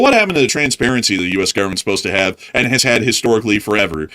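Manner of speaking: usual speaking voice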